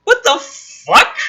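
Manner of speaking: loud, slow, high disbelieving swear; shocked outburst. Real, not acted. Reading the script, stunned by the line, loud. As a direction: blurt it out in total disbelief.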